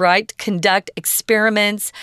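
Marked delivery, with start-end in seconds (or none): 0.4-0.9 "Conduct" as a verb, con-DUCT; stress on second syllable. Shown as a demonstration. Teacher giving students verb examples, second-syllable stress on "Conduct". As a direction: reduce the first syllable of "Conduct", stress the last. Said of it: "which we usually use as a verb".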